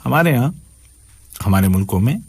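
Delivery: ordinary delivery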